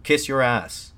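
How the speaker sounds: narrated jeer, punched loud; soldier's shout. A narrator's reading. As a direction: shout an insult up at the speaker. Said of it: "shouted a soldier from below".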